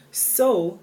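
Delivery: "So" blasted out well above the rest; loud, drawn-out stress on "So"; emphatic SO. Real, not acted. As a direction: lean hard and long on "So". Repeats the surprising reason.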